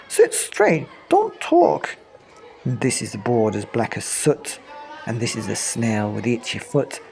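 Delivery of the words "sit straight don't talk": much higher, prim teacher commands; teacher ordering the class; strict teacher. Acted. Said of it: "telling the class".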